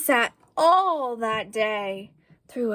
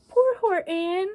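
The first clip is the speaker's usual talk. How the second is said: higher, pitying aside; pitying sigh; 8 semitones higher than usual. A narrator's reading. Narrator pities Horton.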